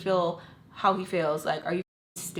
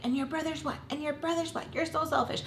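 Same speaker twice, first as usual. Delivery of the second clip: higher, softer mimicked accusation; retold retort, pitched up; firing back; 7 semitones higher, 4 dB quieter than usual. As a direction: imitate her nagging comeback. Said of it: "she would fire back up".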